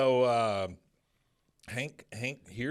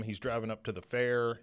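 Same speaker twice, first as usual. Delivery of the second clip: ordinary talk, nothing marked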